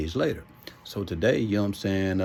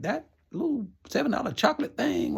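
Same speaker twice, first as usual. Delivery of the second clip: inner disbelief. Retold or imitated. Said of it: "in my mind".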